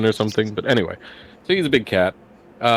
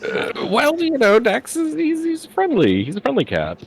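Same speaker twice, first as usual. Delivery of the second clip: high-pitched hedging. Real, not acted. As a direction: defend him sheepishly in a high voice. Defending own character when teased.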